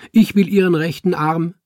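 loud, high-pitched claim; eager demand. A narrator's reading. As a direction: claim it before anyone else can. Student eagerly claims a body part.

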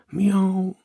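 high, innocent miaow; cat's meow. A narrator's reading. Cat facing the baffled man, meowing.

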